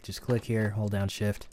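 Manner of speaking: normal talking voice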